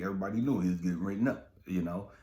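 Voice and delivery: normal talking voice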